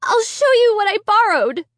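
high, urgent plea; eager to prove it; desperate cry. A narrator's reading. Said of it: "I cried".

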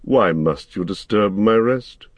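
kindly, aggrieved voice asking; a lightly voiced old wizard feigning injured kindness; gentle question. A narrator's reading. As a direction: sound wronged but sweet, coaxing them. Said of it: "it said now with gentle question".

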